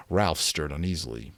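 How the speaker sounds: plain reading voice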